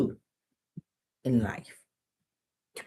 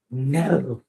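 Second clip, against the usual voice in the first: ordinary delivery, nothing marked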